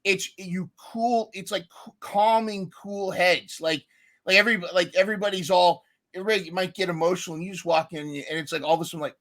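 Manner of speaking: ordinary talk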